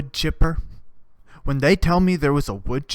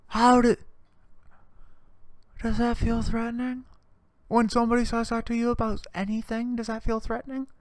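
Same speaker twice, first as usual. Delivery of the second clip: mock incredulous; 8 semitones higher, 3 dB quieter than usual. Real, not acted.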